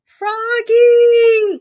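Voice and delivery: high, loud long call; mother calling her son from the pond, loud. Acted. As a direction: call him from across the pond. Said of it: "called his mother".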